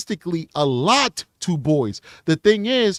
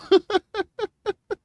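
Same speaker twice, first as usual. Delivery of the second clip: high embarrassed laugh. Real, not acted. Embarrassed laugh after emotion.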